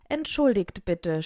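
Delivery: plain reading voice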